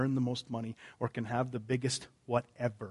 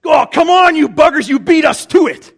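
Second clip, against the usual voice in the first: much louder and higher, mock-indignant complaint; hammed-up disciples griping at each other; jealous disciples; 22 dB louder than usual. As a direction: squabble like rivals who lost the best seats. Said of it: "They said".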